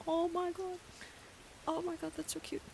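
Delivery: high breathless gush over something cute. Real, not acted. Gushing over a frosty spirit.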